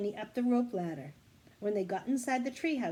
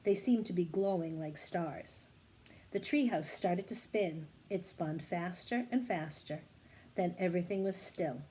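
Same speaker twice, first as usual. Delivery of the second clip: usual speaking voice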